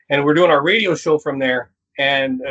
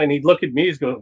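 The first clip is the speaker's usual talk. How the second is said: ordinary talk, nothing marked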